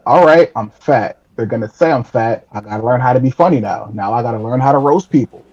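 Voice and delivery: normal talking voice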